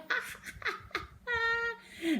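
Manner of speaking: high mocking class laughter; high giggling laugh. The class laughs at Zoe leaving, high burst. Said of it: "an enormous eruption of laughter".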